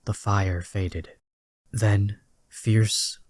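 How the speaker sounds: ordinary delivery, nothing marked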